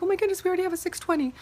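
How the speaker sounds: high, fast squeal of surprise; retold shock. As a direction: gush it out in happy disbelief. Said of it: "I was shocked".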